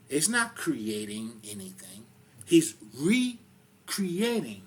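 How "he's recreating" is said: "recreating" drawn out very slowly, higher and louder; stress on recreating. Real, not acted. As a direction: lean hard on "recreating". Stresses recreating against creating.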